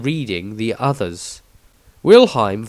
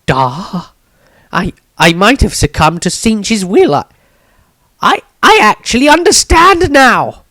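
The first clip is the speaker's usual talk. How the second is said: higher, louder, stammering awe; awed realization; 11 semitones higher, 10 dB louder than usual. A narrator's reading. Maestro realizes the danger, awed and stammering. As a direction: gasp it out as it dawns on you. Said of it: "with a sense of awe and realization".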